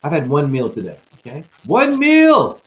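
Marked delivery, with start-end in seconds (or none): 1.6-2.6 high, loud exclamation; emphatic outburst. Real, not acted. Telling viewers about eating just one meal, emphatic shout. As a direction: shout it out to drive the point home.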